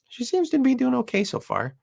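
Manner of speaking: ordinary talk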